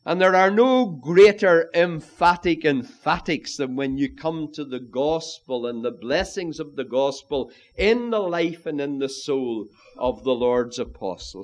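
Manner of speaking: ordinary talk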